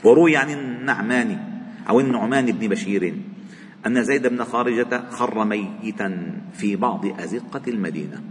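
usual speaking voice

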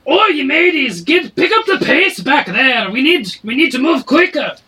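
high, loud barking tavern keeper; a barkeep shouting from behind the bar; dwarf barkeep. Acted. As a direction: bark orders at the kitchen staff. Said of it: "this voice, behind the bar that says".

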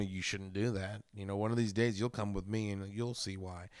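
a hint of a soft, quiet old mentor; lightly voiced wise old man warning. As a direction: murmur it like a gentle wise elder. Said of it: "like the wise old".

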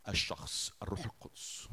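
soft, breathless whisper of the name; reverent whisper. Acted. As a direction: whisper it in awe. Reverent naming of the Spirit.

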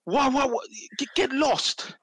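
high, exasperated why get lost; fed up, flinging the question out; exasperated outburst. Real, not acted. Ranting at too many international breaks, exasperated.

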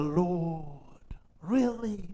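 half-joking disbelief aimed at God; incredulous whisper. Retold or imitated. Complaining to the Lord on the plane, a whispered disbelieving aside. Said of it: "And I said".

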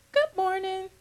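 a lightly voiced coworker answering "Good morning"; coworker's reply. Coworker answering the preacher's greeting, lightly voiced. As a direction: toss the greeting back.

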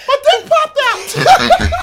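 very high, loud, drawn-out squeal; hysterical laughter. Real, not acted. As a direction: shriek the punchline while laughing helplessly. Blurts punchline while cracking up.

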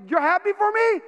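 high-pitched, elated question; delighted. Retold or imitated. Golfer to his laughing partner, delighted.

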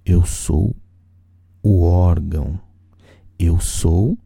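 ordinary delivery

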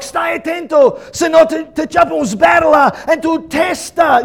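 loud, high Italian scolding; threatening Italian dad. Retold or imitated. Son imitating dad's threat in Italian, loud and high. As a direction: mimic an Italian father's heated warning. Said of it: "in Italian he would say".

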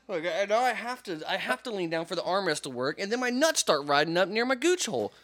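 high, incredulous ranting; exasperated rant. Real, not acted. Complaining armrests don't work, high exasperated rant. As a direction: rant in a high, worked-up voice with loud bursts. Rant about uncomfortable chairs.

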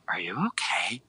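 a soft unvoiced whisper of concern; whispered question. Classmate whispering to a crying friend, hushed concern. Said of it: "she's like".

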